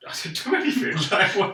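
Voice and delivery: high-pitched mock protest; protesting innocence. Kid accused by grandma in front of parents, indignantly innocent. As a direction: squeal the denial like an accused kid. Retold denial to accusing family.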